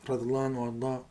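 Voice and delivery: usual speaking voice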